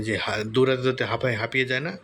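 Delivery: usual speaking voice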